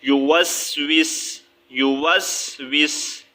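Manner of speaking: یو وس وس spoken slowly, the final seen made silent; slow, stop cleanly on the seen of وس; waqf on the seen. Shown as a demonstration. Teacher slowly shows students the waqf stop. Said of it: "سین کو ساکن کریں گے".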